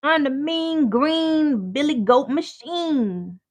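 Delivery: high, loud and slow, mock menacing; mean villain voice. To the chat, a hammed-up mean villain boast. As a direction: announce yourself like a cartoon bully. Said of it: "I'm mean".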